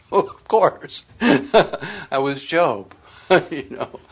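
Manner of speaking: laughing through the words. Real, not acted. Preacher laughing at his youthful pride. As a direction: laugh it off at himself.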